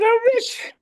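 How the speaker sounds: very high, comic squeal of glee; gleeful crowing. Retold or imitated. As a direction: squeal it as a laughing punchline. Repeats his punchline amid laughter.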